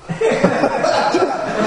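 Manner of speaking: high breathy laugh; real burst of laughter. Real, not acted. Laughing at the pastor ordering meatloaf, high burst. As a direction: crack up at the punchline.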